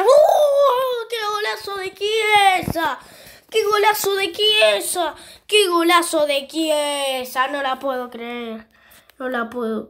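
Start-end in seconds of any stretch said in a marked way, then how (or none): 0.0-7.5 high, loud goal scream; breakneck goal commentary. Real, not acted. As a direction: yell the goal in pure joy. Goal scored in the game.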